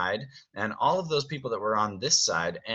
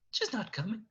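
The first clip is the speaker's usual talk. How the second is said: high, small, softer voice; Cogsworth, very nervous; 12 semitones higher, 7 dB quieter than usual. Acted. Nervous servant confessing to Beast, meek and quiet. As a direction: shrink away while admitting it. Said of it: "very nervous looking".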